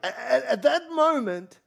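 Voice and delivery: high, slow, laughing "at that moment"; laughing emphasis. Real, not acted. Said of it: "everybody say".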